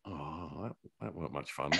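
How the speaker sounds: dropped-down grumpy inner voice; quiet mock grumble. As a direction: mumble it like a disappointed aside. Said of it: "just thought".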